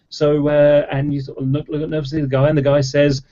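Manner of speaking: ordinary talk, nothing marked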